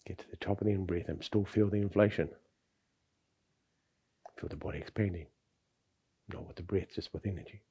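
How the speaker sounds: soft, calm breathing instruction; guided breathing. Acted. Guides breathing exercise, soft and slow.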